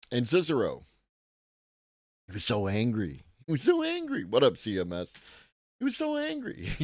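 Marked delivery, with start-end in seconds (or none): 3.5-4.2 much higher, mocking falsetto; a mocking high-pitched take on his anger; mock squeaky high voice. Mocks angry guy in squeaky voice. 5.8-6.7 much higher, mocking falsetto; a mocking high-pitched take on his anger; mock squeaky high voice.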